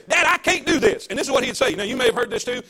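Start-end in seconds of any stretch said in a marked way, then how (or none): 0.1-1.1 imitating a child's whine to Dad; a hint of a kid complaining; child pleading with Dad, lightly voiced; whiny kid. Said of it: "we'd come back to Dad and say".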